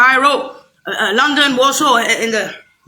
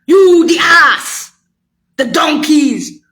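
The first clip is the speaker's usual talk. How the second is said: loud, slow, drawn-out insult; slow furious shout; 3 semitones higher, 5 dB louder, much slower than usual. Real, not acted. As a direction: bellow each word slowly in anger. Ranting at listeners.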